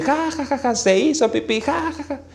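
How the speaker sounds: singsong mockery of the boy who wet himself; mocking kids' laughter. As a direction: laugh at him like a jeering child. All the kids mocking.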